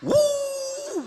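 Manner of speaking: long high "wuh" wail; hammed-up crying howl; mock crying wail. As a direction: imitate a held, high-pitched sob. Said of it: "ah, nangis di situ".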